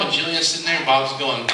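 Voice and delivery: usual speaking voice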